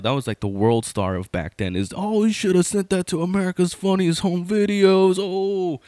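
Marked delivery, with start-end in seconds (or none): 2.0-5.8 imitated jeering yell. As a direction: yell it like a heckling onlooker, pitched way up. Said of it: "that was the thing to yell".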